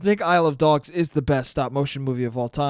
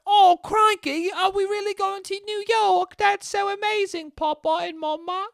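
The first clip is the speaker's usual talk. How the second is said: put-on British boy voice. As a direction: squeak it out like an excited English boy. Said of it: "James is like".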